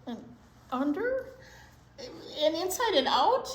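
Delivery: high, sing-song storybook reading; reading to children. A narrator's reading. Librarian reads story to children, sing-song. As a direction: read it like a picture book for kids. Reading picture book aloud.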